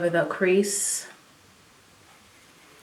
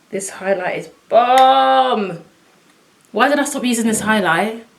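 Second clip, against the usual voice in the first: excited; 4 semitones higher, 5 dB louder than usual. Real, not acted. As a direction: savor each word with delight. Raving about highlight.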